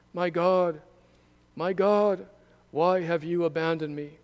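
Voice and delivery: high, loud cry of abandonment; loud anguished cry. A narrator's reading. Jesus on the cross crying out to God, loud anguished cry. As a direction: call out loud as if forsaken. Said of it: "called out with a loud voice".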